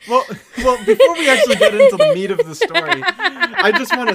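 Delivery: talking through high laughter; giggling while getting the story going; laughing. Real, not acted. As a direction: laugh your way into the sentence.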